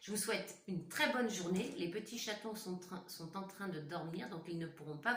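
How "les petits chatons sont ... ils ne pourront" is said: much softer, lowered so the kittens sleep on; teacher hushing her voice for sleeping kittens; hushed for sleeping kittens. Real, not acted. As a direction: keep it down, the kittens are asleep.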